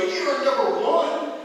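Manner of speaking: loud, slow sarcastic jab at the other driver; shouted sarcasm, drawn out; mock rude driver. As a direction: mimic an angry driver yelling. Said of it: "And they go".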